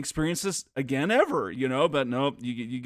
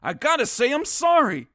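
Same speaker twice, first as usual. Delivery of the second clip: high, loud acted protest; hammed-up disbelief at being told off; mock indignant; 12 semitones higher, 6 dB louder than usual. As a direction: play it put out, pitched way up. Acted quarrel between game characters.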